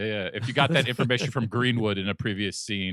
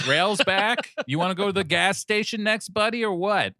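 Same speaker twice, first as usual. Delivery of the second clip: higher, chummy mock voice; mock movie character; 8 semitones higher than usual. Mocking the script's lazy scene change, higher voice. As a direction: breeze through it like a goofy sidekick. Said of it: "so they were like".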